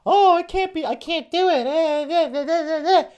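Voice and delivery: high-pitched mock whining, dissolving into fake blubbering; whiny crybaby. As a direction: mimic a pathetic crybaby in a squeaky high voice. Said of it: "crying like a little bitch, saying".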